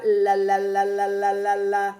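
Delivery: rapid chain of "la" on one level note; letter sound demo. Shown as a demonstration. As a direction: sound out the letter L as a loud, repeated "la". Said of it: "that L makes that".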